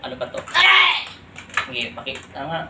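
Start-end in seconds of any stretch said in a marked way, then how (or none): 0.5-1.1 pained cry. Real, not acted. Pain cry during exorcism.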